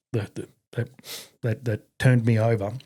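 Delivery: ordinary talk, nothing marked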